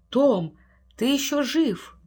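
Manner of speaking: high, drawn-out exclamation; amazed relief. A narrator's reading. Old man seeing the writer's lines resume, amazed relief. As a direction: exclaim slowly in wonder.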